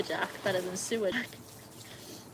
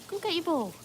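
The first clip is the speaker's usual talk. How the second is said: 1 dog-talk voice; 9 semitones higher, 4 dB louder than usual. Real, not acted. Urging dog.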